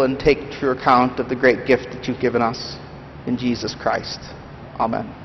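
calm, earnest prayer voice. Real, not acted. Pastor praying over the congregation, earnest and steady. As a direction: lead the congregation in quiet prayer.

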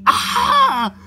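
sudden high shriek; terrified scream. Acted. Friend sees the doctor, terrified scream. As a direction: shriek in terror.

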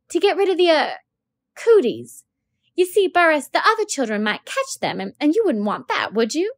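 teacher asking a dirty pupil to spell his name; flustered teacher. Acted. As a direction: coax the boy politely, a little flustered.

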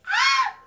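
very high, loud fangirl scream; excited squeal. Acted. Asked for her number by her idol, excited scream. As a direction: scream with overwhelmed delight.

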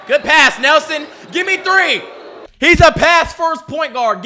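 loud, high hype commentary; excited cheering. Real, not acted. Viewer cheering Nelson's pass, excited cheering. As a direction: holler praise, pitch up.

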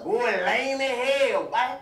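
ordinary delivery

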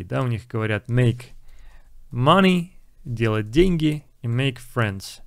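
normal talking voice

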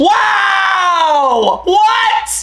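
shocked shout, high and loud. Real, not acted. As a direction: yell it out in total shock. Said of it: "I was shocked".

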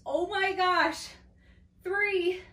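high, loud strained gasps; straining effort. Real, not acted. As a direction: push through the burn out loud. Straining at end of set.

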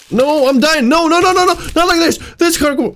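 frantic pleading not to die; panicked protest. Real, not acted. Gamer panicking as his character dies, frantic high cries. Panicking over dying in game.

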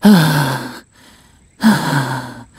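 panting from the chase; out of breath. Acted. After chasing his friend down, breathless panting. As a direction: catch your breath after running.

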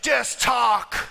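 loud, punchy command; preaching shout. Real, not acted. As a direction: drive the point home loudly. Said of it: "exhorting congregation".

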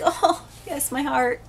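high, gushing swoon. Retold or imitated. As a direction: melt over the sweet moment. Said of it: "And I'm like".

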